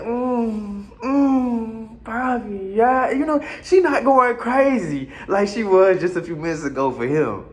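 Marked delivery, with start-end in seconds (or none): 0.0-3.1 mock moans. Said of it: "she like".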